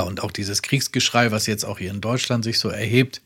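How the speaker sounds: normal talking voice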